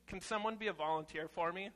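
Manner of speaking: ordinary talk, nothing marked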